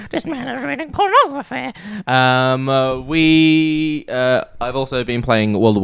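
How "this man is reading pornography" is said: high, outraged granny voice; mock accusing old lady. Imagined old lady reporting him, high mock outrage. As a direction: point and tattle like a prim lady.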